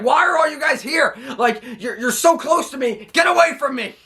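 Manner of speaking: mock tantrum, pushing them all away; whiny Kevin Owens tantrum. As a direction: Kevin Owens whining at the crowd around him, high mock tantrum. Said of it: "Kevin Owens is like".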